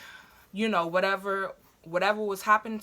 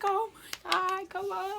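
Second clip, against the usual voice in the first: very high, thin drawn-out wail; imitated scream; 12 semitones higher than usual. Screaming in the waiting room, high thin re-enactment. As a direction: mock-scream it without full volume. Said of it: "I'm screaming".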